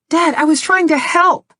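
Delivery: high, pleading daughter; hurt protest. Acted. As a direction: cry out the protest.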